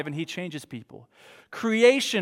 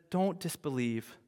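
fading from soft to a whisper on believe; soft, ending whispered; gently urging down to a whisper; hushed plea; 3 semitones lower, 12 dB quieter, slower than usual. Acted. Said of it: "Jesus said".

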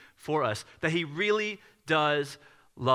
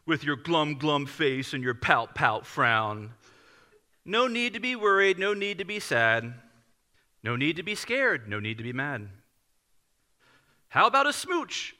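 picture-book reading. Retold or imitated. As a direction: read a rhyming children's book aloud. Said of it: "Let me read it to you".

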